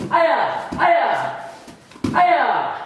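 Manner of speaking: three high, sharp martial arts yells. Shown as a demonstration. Combo shouts at max speed.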